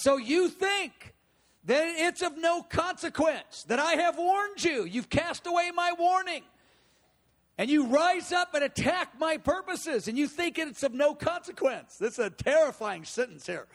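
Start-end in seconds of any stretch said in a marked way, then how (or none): 0.0-12.0 God's stern warning. Retold or imitated. God warning Tyre and Sidon, in a raised stern rebuke. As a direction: thunder the warning down on them. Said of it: "He says".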